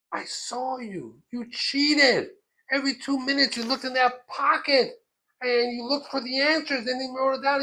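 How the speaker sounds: loud, high-pitched tirade; angry accusation. Retold or imitated. Rebbe accusing pupil of cheating, angry.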